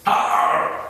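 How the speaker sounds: loud, rough "Arr" of an attacking wolf; wolf howl. A narrator's reading. Wolf falling upon Fitzsimmons' feet, howling. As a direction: roar it out like a pouncing wolf. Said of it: "howled the wolf".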